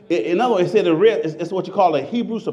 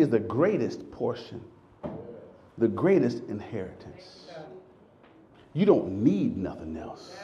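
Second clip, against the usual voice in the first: much softer, lower, after a long pause; hushed preaching; 7 semitones lower, 6 dB quieter than usual. Acted. Pastor to congregation after a dramatic pause, hushed. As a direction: let it fall quiet and sink in.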